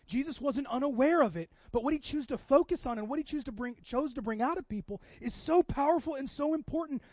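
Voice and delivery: high, loud, rising sermon; impassioned preacher voice; full-force preaching. Acted. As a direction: drive the point home to the church.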